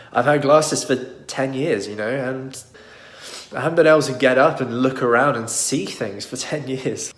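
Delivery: holding back tears through a little laugh; tearful. Real, not acted. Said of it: "I'm tearing up now".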